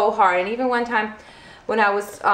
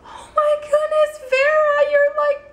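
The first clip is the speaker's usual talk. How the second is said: shocked. Retold or imitated. Midwife walks in to find Vera already pushing, shocked high exclamation. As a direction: blurt it out in high, stammering disbelief. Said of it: "she was so shocked".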